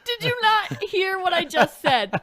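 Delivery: loud, high laughing protest; laughing disbelief. Real, not acted. Incredulous laughing friend.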